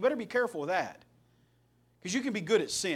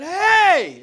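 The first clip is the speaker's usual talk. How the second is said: much higher, full-volume Hey; loud yell; 12 semitones higher, 15 dB louder than usual. Retold or imitated. Standing up at a concert, yelling Hey at the troublemakers. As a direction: yell it to stop a whole section. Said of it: "as loud as I could".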